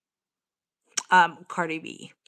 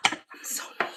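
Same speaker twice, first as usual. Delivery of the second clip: breathy whisper to herself; whispered self-blame; 12 dB quieter, much faster than usual. Real, not acted. Scolding herself in a whisper about the cat. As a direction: hush it out, ashamed.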